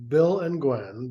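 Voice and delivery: usual speaking voice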